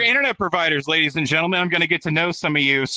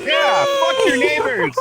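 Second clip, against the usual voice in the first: shout; 5 dB louder, slower than usual. Acted. Host hyping crowd.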